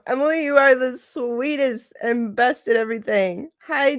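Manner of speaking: normal talking voice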